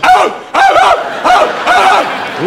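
very high pained squeals; dog groaning. Retold or imitated. Dog straining while dumping, very high squeals. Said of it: "wanna see a dog show some emotion".

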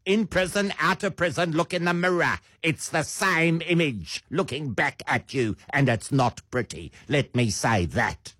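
put-on high, affected Sandton socialite accent; rich Sandton accent. Catty advice to an ex-prisoner, rich Sandton accent. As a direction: play a rich Sandton house husband's accent. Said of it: "rich house husbands of Sandton".

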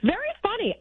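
sarcastic. Real, not acted. Producer answers the host's teasing, sarcastically. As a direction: toss the jab right back at him. Dry reply to host's jab.

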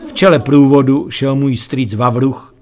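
plain reading voice

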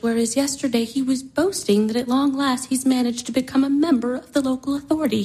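usual speaking voice

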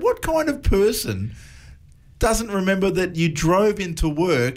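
slow, high-pitched disbelief; retold incredulity. Dad questioning forgetful son, retold incredulous scolding. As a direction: draw out the mock-baffled question, pitched well up.

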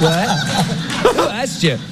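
high, laughing outrage; giggling mock indignation; laughing disbelief. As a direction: laugh it out, incredulous. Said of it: "And I was like".

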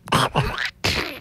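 wordless, squawky duck quacking; squawked duck-voice noise; Donald Duck noise. Shown as a demonstration. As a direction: attempt a Donald Duck quack without words. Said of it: "I can kind of make the noise".

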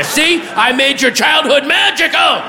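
put-on high, indignant drunken boast; boastful rant. Dr. Seuss bragging at a bar, high rant. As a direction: crow it in a high strained yell.

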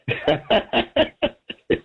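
sudden high laugh; high burst of laughter. Real, not acted. Laughs at own story.

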